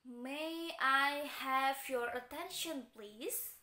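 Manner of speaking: very slow, carefully spaced May I have your attention please; teacher modelling a polite request. Shown as a demonstration. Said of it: "kita bisa menggunakan".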